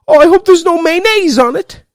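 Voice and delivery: high, loud old-lady imitation; imitating his nana. Mimicking his nana fussing about mayo, high old-lady voice. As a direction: squeak it out like a fussy grandma. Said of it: "she used to say mayonnaise".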